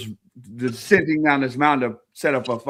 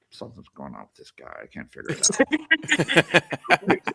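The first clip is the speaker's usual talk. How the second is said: neighbors voice; 3 semitones higher than usual. Retold or imitated. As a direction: mutter it like a suspicious neighbor. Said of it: "they're like".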